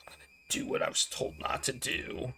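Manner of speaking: hushed, voiceless confession; whisper. Acted. Player confessing she will break the rule, in a whisper. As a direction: whisper it like a guilty secret.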